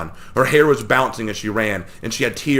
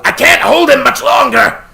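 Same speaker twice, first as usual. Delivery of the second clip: high, loud strained yell; straining shout; 11 dB louder than usual. A narrator's reading. Warren strains against Mamaragon, straining yell. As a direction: shout while holding him back.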